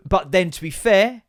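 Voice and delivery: ordinary talk, nothing marked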